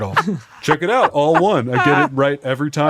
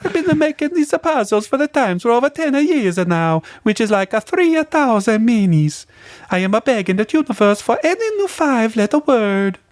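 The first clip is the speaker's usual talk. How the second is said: put-on high Italian accent, Luigi style; 12 semitones higher than usual. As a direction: play it like a cartoon Italian plumber. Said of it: "Yeah, do it like Luigi".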